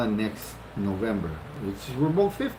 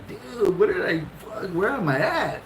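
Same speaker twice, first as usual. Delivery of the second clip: alarmed thought; 8 semitones higher than usual. Retold or imitated. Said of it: "I'm thinking to myself".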